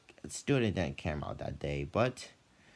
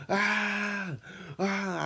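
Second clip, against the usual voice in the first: retold scream. Student straining during class workout, high screams. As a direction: yelp it out mid-workout. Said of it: "I was screaming".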